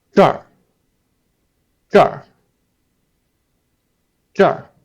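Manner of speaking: 这儿 said high and clear as the right form; correct 这儿. Shown as a demonstration. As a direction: model the right 这儿. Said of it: "don't say 这儿".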